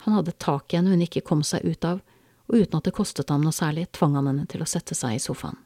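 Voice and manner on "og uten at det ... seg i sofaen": ordinary delivery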